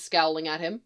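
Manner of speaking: ordinary delivery, nothing marked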